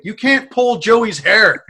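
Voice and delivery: loud, slow, high retold scolding; emphatic scolding. Bartender buddy explaining the ban to troublemaker, emphatic scolding.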